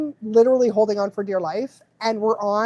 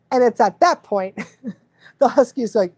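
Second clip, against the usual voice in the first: ordinary talk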